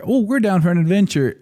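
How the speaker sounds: lightly voiced eager parents, high pitch; parents' voice. Son imitating his parents agreeing to travel, lightly put-on high voice. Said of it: "they're like".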